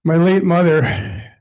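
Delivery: ordinary delivery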